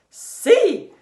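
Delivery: high loud shout. Acted. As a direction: shout it out boldly. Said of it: "Bismarck shouted".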